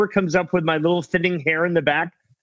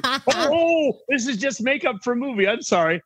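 high, flustered sorry, fading down; flustered apology; 7 semitones higher than usual. Retold or imitated. Actor to gas station guy, high flustered apology. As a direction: squeak out an embarrassed excuse. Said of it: "I was like".

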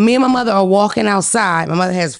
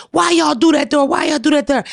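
mild crowd yell; 5 semitones higher, faster than usual. Retold or imitated. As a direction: heckle like the crowd. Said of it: "they yelling".

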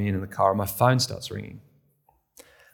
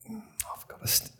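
inner whisper; 12 semitones higher, 13 dB quieter than usual. Retold or imitated. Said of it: "inner panic in the car".

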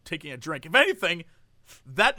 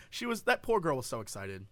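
ordinary talk

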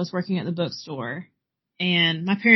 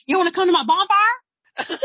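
excited; 11 semitones higher, 7 dB louder than usual. Retold or imitated. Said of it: "and I was like".

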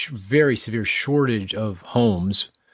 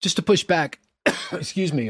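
coughing through the words after choking on coffee; throat still catching, real cough breaking in; 6 semitones higher than usual. Real, not acted. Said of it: "went down the wrong pipe".

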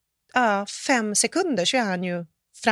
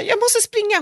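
much higher, frantic retold panic; panicked; 13 semitones higher, 3 dB louder than usual. Facing a coyote, telling Emilia she must run, high panic. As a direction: squeak it out like you're about to bolt. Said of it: "Och jag bara".

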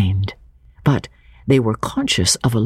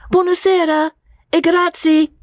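higher-pitched Italian cabman voice; an Italian driver calling out, higher. Acted. Cabman calling to George, higher Italian voice.

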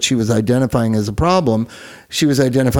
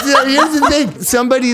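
higher, laughing through the words; 10 semitones higher than usual. Real, not acted. Still laughing at her own joke to the guests. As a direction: laugh as you get back to the point.

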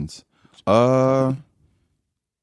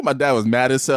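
ordinary talk

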